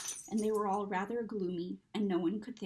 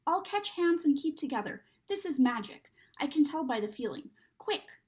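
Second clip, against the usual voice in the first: urgent; 5 semitones higher, 6 dB louder than usual. Acted. Children seized by magic.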